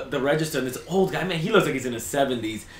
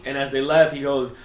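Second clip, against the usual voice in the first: ordinary talk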